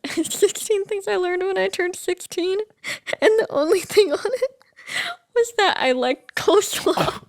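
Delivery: high-pitched, laughing through the words. Real, not acted. Host confessing the only thing on their old list, laughing. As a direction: struggle to get it out through laughter. Cracking up over old coleslaw note.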